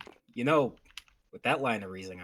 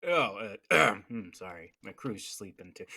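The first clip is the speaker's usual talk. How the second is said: usual speaking voice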